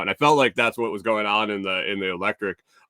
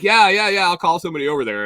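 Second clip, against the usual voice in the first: much higher, louder rapid yeahs; impatient employee already leaving; hurried brush-off; 8 semitones higher, 6 dB louder, faster than usual. Retold or imitated. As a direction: wave him off while rushing away. Employee on a hot mission brushing off.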